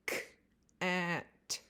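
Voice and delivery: K-at with the k split off from at; segmented. Shown as a demonstration. Teacher breaking cat into sounds for a child. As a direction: separate the k sound before at. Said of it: "did you hear any other sounds".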